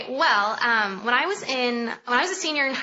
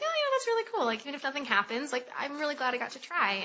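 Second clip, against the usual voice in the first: acted cheerful acceptance; 4 semitones higher, 6 dB quieter than usual. Her past self after audition.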